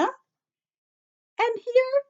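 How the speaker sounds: excited pointing out. Real, not acted. Teacher showing ladybug eyes, excited high call. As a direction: draw attention to something small.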